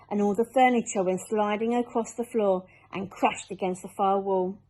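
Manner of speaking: ordinary delivery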